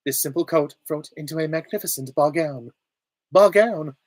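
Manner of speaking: high, slightly robotic woman's voice; comic stilted good-witch delivery; Glinda. Acted. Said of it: "sound a bit robotic".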